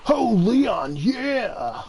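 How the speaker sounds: high, stretched mascot holler; Mister Kool-Aid. Retold or imitated. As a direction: burst in with a hammed-up catchphrase. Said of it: "He breaks through like Mister Kool-Aid".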